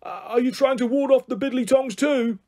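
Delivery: high-pitched called-up question; eccentric grandpa. Acted. Grandpa calls up to his wife about enemies, eccentric. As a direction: ask it upwards, earnestly eccentric.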